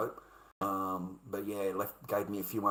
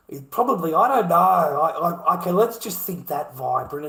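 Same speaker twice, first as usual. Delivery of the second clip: worked up; 4 semitones higher, 10 dB louder than usual. Real, not acted. Worked up over the stranger.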